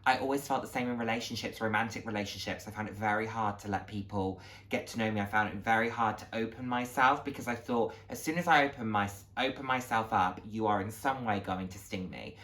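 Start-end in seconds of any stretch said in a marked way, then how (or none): none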